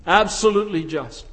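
ordinary talk

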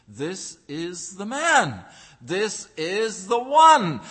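full-force preaching. Real, not acted. Preaching climax.

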